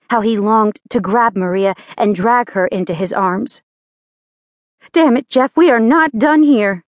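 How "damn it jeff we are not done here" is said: angry, raised voice. Acted. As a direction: shout at him in fury. Maria furious at Jeff.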